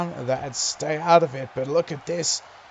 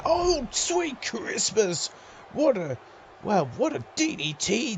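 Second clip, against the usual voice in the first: excited exclamation; 8 semitones higher than usual. Real, not acted. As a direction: stretch out the amazement. Excited exclamation at big move.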